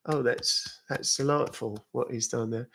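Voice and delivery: soft, proper British accent. Shown as a demonstration. Streamer showing how a British accent gets applauded, very proper British accent. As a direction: put on a refined British accent. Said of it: "very proper British accent".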